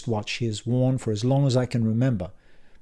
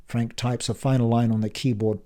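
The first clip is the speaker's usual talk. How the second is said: ordinary delivery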